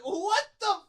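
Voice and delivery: slow, high, loud disbelief; retold flabbergasted shout; incredulous outrage. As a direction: drag out each word in mock outrage.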